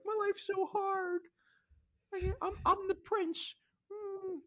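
slow, very high whiny sobbing; hammed-up crybaby prince wail; mock crying. Host mocks Aegon crying to himself, fake sobbing. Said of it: "he starts crying like a little".

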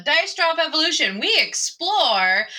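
slow, high mock preview; announcer voice. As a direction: announce it like a teaser.

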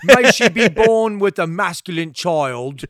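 hammed-up Cockney mob boss; mangled Cockney, swelling loud on the key lines; Godfather in bad British accent. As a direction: do a Godfather speech in a clumsy, weird British accent. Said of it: "Godfather stuff".

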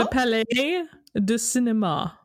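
Palais said "pa-LAY", final s silent; French pronunciation. Shown as a demonstration. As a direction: model a French Palais du Cinéma, pitched a little up. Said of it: "form your words differently".

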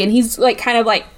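usual speaking voice

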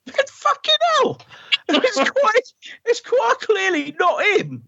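very high put-on exasperated voice; high, laughing mock Ben; Ben impression. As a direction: squeak it out in disbelief. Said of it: "He goes".

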